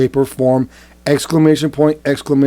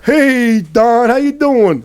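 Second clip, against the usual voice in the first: higher, slower, sugary mascot greeting; children's show mascot; 8 semitones higher, slower than usual. Acted. Mock party host greeting Trump, children's show mascot voice. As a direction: greet him like a kids' TV host. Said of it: "Why do you sound like a children's show, like, mascot".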